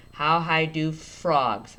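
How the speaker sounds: drawn-out phonics read; stretched out. Shown as a demonstration. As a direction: stretch each word to hear the letters. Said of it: "I'm gonna stretch it out".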